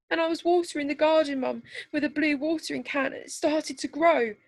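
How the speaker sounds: put-on high, fast little girl's voice through tears; a small child blurting out a bad dream; tearful child. A narrator's reading. Little daughter telling Mum her nightmare, high and tearful. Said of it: "Therese's diluted by her tears".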